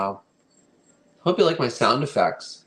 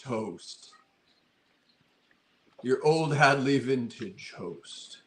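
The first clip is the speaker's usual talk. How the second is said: slow, eerie spooky host; ghost host voice; much slower than usual. Pretend. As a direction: welcome guests like a haunted house ghost. Haunted Mansion ghost host impression.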